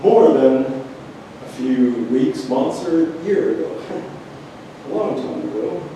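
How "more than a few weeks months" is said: usual speaking voice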